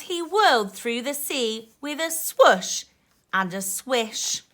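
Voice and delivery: put-on lively rhyming read-aloud for kids; reading to children. A narrator's reading. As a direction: bounce the rhymes like a bedtime book. Reading rhyming picture book aloud.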